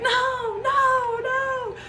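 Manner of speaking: high, wailing sobbed protest; bawling no. Retold or imitated. Sobbing no to the midwife about the hospital. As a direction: cry it out, breaking and high. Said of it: "And so I'm just bawling".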